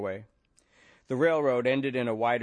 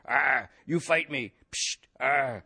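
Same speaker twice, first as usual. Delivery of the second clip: slow, slurred, louder belligerent addict; mock junkie; 3 semitones higher, 4 dB louder, slower than usual. Chair carrier miming a belligerent junkie for the cops. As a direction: stagger it like a junkie. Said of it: "bellicose junkie".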